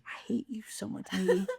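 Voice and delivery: unvoiced, intimate mock hate; mock whisper. Real, not acted. Co-host whispering hate at the movie. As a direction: whisper it like a grudge.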